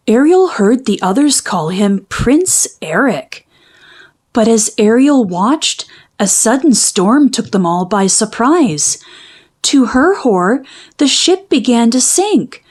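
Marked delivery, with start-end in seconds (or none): none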